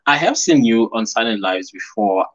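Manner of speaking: ordinary talk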